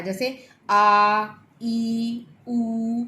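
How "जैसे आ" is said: आ drawn out slowly as a long vowel; long vowel demo. Shown as a demonstration. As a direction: stretch the आ in "जैसे आ" slowly. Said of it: "ज़्यादा समय लगता है".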